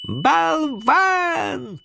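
very high, drawn-out cry; high-pitched, stretched call of scorn; shouted insult. A narrator's reading. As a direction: shriek it down from a tree. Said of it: "крикнула с дерева Динь".

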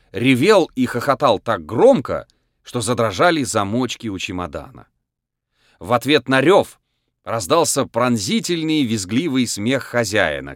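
ordinary delivery, nothing marked

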